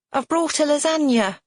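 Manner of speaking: high, loud cheerful call. A narrator's reading. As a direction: call it down the hall. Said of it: "a voice calls".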